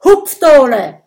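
loud, high string of shouted insults; shouting insults. Acted. Brodier shouting insults at Gudröd, loud. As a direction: hurl insults while scrambling for words. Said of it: "ruft Brodier".

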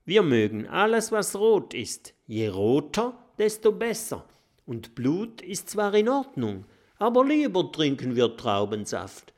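high, quick little vampire voice; eager little vampire lecturing; chirpy little vampire. Acted. Bisbert explains vampire tastes.

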